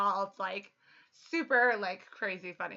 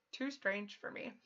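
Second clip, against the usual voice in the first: ordinary talk, nothing marked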